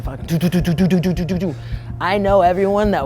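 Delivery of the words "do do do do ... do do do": rhythmic mouth-made heartbeat, rising loud; nervous heartbeat sounds before the jump; imitated heartbeat. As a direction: tap out a racing heartbeat with the voice. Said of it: "heart's beating".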